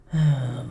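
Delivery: loud, short exhaled sigh; exasperated sigh. Acted. Dragon done scolding the knight, exasperated sigh. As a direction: sigh before returning to the question.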